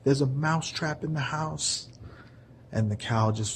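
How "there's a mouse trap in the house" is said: high, frantic mouse begging the cow; a tiny mouse desperate for help; pleading mouse. Acted. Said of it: "was like".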